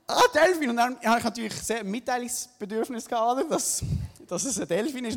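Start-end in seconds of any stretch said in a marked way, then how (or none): 0.1-0.7 high, loud thrilled exclamation; delighted cry. Retold or imitated. Surfer spotting a dolphin, loud high delight. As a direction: burst out with joy at the sight. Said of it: "Ich habe mich so gefreut".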